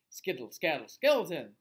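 loud scared chant at the skeleton; frightened shout. Acted. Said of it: "shouted the old lady".

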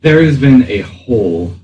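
normal talking voice

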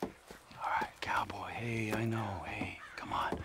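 soothing whisper. Acted. Soothing whisper before attack.

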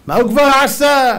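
high, loud scoff; indignant, belittling outburst; dismissive mock yell. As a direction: shout it as an ungrateful person would. Acts ungrateful person's dismissal.

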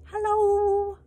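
loud, high, stretched yowl; piercing midnight yowl; yowled hello. Acted. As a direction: sing it out like a cat at night. Cat yowling greeting at night.

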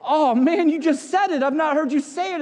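excited surprise. Retold or imitated. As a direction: act amazed he said it. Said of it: "I was just like".

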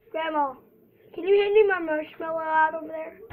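loud call across the room. Real, not acted. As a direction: call to someone far off. Calling to grandma across room.

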